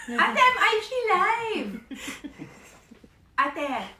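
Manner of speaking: shouting to sister with a laugh. Real, not acted. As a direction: yell it through a laugh so she finally hears.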